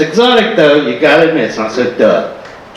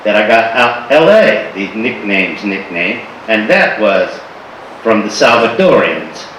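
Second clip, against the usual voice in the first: ordinary talk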